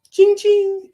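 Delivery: high, loud ringing ching; cash register ching. Acted. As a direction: sing out a bright cash-register ring. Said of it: "that's the sound my heart makes".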